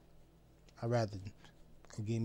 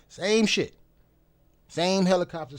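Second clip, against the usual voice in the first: exasperated emphasis; 6 semitones higher, 6 dB louder than usual. Real, not acted. Repeats the rant line.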